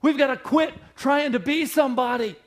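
loud, high pulpit cry; preached shout. Acted. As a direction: hammer the refrain home. Shouted sermon refrain.

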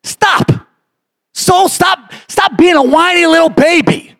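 pleading sermon at full force; fiery preaching. Acted.

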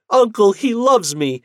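high, loud delighted news; happy wife reporting to her uncle; joyful. Acted. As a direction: beam it to your uncle.

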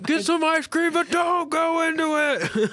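high, loud mock-dramatic call; hammy shout. Co-host jokes about the light, high hammy shout. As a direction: yell the joke like a soap opera. Joke dying-light plea.

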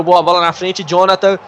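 usual speaking voice

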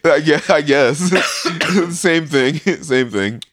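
words broken by giggles; laughing. Real, not acted. Laughing at friend's comparison.

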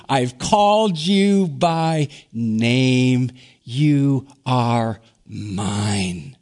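slow, hushed and tender; tender scripture voice. Acted. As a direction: linger on every word like a promise. God's words from Isaiah.

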